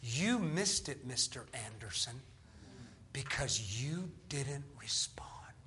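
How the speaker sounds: slow, low, fading into a whisper; grave, near-whispered re-enactment; hushed solemn verdict. Retold or imitated. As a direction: deliver the retold verdict very softly, word by word. Retold verdict to the man unhealed.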